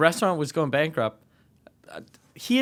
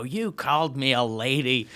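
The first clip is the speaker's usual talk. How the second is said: mock sulk; 3 semitones lower than usual. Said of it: "sulk on the phone and go".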